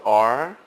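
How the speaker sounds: ARE drawn out and rising; stress on ARE. Shown as a demonstration. Teacher pointing out the second word, ARE stressed. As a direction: lean on "are", lifting it at the end. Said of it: "stressing on the word, uh, the second word".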